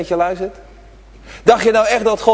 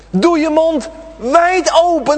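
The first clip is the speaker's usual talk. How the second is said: wide-mouthed call; 9 semitones higher than usual. Shown as a demonstration. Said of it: "Je kijkt zo in zijn keel".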